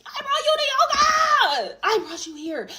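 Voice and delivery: high, loud outraged shriek; screaming. Retold or imitated. Storyteller screaming at her ex at the yoga class, high outraged shriek. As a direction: shriek it at her, losing it completely.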